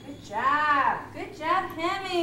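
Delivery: loud, high cheering good job; excited praise. Real, not acted. Healer cheering Hemi after the tapping, loud praise. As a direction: cheer the dog on.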